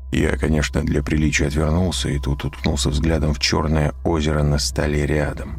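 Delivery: ordinary delivery, nothing marked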